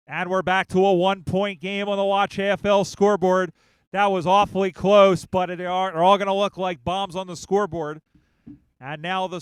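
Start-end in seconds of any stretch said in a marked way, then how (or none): 0.1-8.0 excited commentary. Real, not acted. Commentator reacts to close score.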